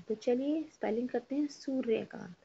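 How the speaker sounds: usual speaking voice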